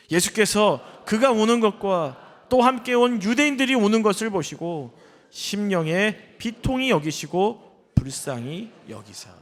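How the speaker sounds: slow, measured reading that starts loud and settles down; scripture reading. Acted. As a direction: read the verse out phrase by phrase. Reads scripture aloud to congregation.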